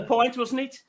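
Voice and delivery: high-pitched Brummie barman taking an order; Brummie accent. Retold or imitated. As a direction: switch into imitated Brummie. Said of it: "He sounded like he was a Brummie".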